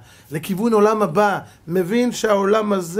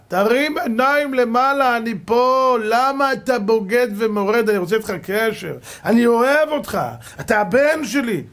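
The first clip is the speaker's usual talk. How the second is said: God pleading as father; 5 semitones higher, 5 dB louder than usual. Acted. God calls to the rebellious son, loud pleading father. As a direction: call down like a loving father begging his son to look up.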